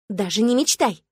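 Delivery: mild snapped refusal; understated angry retort. Acted. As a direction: bark it at him, half-hearted. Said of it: "рявкнула я".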